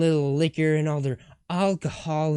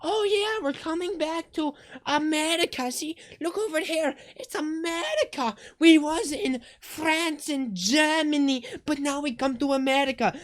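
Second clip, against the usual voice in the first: mock American accent; 14 semitones higher than usual. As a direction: play up a goofy American soldier accent. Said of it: "I had lost my American accent".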